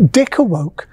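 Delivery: plain reading voice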